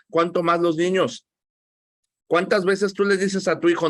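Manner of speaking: ordinary delivery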